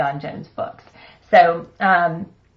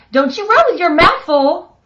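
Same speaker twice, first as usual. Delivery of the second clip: higher, louder motherly scolding; scolding holler; 8 semitones higher, 6 dB louder than usual. Acted. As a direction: call out a warning to the running kid. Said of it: "hollered Mama".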